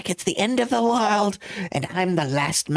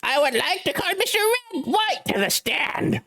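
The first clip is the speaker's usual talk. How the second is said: character voice; 8 semitones higher than usual. Acted. Playing a game courtroom character.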